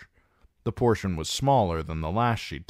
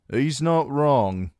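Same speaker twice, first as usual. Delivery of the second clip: slow grumble, higher and louder; grumbling; 9 semitones higher, 6 dB louder, slower than usual. A narrator's reading. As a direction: mutter grudging agreement. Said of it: "grumbled Ned Woods".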